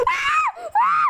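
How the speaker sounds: piercing high shrieks; terrified screams. Real, not acted. Monster closing in.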